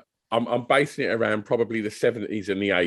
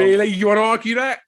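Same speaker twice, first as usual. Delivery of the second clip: mock challenge; 12 semitones higher, 6 dB louder, faster than usual. Real, not acted. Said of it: "challenging friend jokingly".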